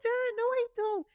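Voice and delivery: put-on very high little-girl protest; mock toddler voice denying it; imitating her child self. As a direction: squeak it out like a confused small kid. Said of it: "So I was like".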